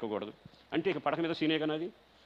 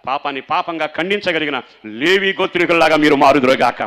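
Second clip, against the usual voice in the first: preaching at full force; 3 semitones higher, 15 dB louder than usual. Acted. Pastor exhorting the congregation to say amen, at full force. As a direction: thunder it at the congregation.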